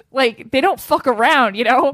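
ordinary delivery

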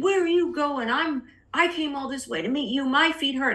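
mock him whining about his sore feet; whiny boyfriend. Boyfriend whining at her as she stomps away. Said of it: "he's like".

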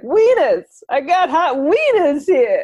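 loud stadium hawker call, a bit slow; hot dog vendor. Retold or imitated. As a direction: shout it like a ballpark vendor. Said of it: "he's like".

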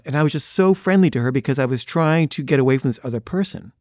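ordinary talk

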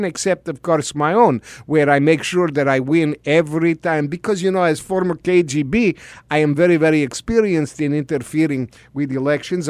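lightly put-on Russian accent, mock Putin; a hint of Russian accent, playing Putin for laughs; Putin impression. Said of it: "Mister President Putin".

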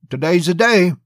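loud, high burst of exasperation; exasperated. A narrator's reading. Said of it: "exasperation in his voice".

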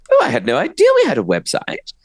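lightly voiced posh shop lady, high and airy. Shop lady telling customers she knew nothing of the website, high posh voice. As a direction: play the posh shopkeeper, breezy and vague. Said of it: "And the lady went".

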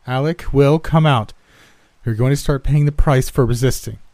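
high, loud threatening call; shouted. A narrator's reading. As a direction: shout a warning into the house. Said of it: "She shouted next".

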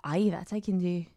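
put-on Scottish accent. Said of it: "can you do Scottish?".

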